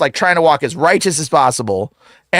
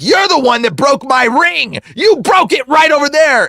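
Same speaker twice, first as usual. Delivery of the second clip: hammed-up angry demon, raised pitch; demon's accusation; 7 semitones higher than usual. Demon accusing the man who broke his ring, angry.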